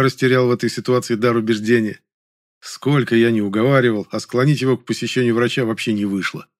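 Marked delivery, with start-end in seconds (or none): none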